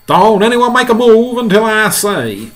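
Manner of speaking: high, loud command; shouted order. Acted. Fire chief holding his men back, shouted order.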